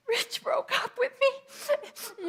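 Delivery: put-on high, slow teenage whimper; teary teen whine. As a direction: play your heartbroken teen self, voice up high. Teen self crying to dad.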